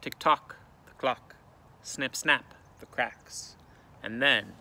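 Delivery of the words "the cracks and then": plain reading voice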